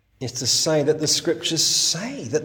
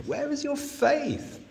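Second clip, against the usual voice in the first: higher, slow, lightly voiced Jesus; Jesus rebuking; 13 semitones higher, 4 dB quieter, slower than usual. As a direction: ask it as a gentle reproach. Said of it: "Often Jesus would say to his disciples".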